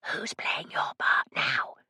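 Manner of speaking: soft breathy whisper, slow. A narrator's reading. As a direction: whisper behind a hand. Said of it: "I whispered to him".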